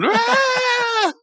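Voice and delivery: high, sustained "aah" scream; imitated scream. Host mimics Rigby screaming on top of the table, imitated scream. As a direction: mimic a cartoon character's drawn-out scream. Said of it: "it's still the same scream".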